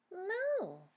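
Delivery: high little moo; Pip's squeaky moo. Acted. Little Pip copying the calves, squeaky. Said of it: "said Pip".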